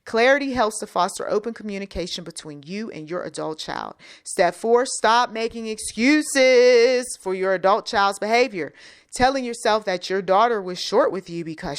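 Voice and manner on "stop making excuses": EXCUSES drawn out loud and high; raised, stretched-out warning on "excuses"; emphatic command. Real, not acted. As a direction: lean hard and slow on "excuses". Reads step four as a command.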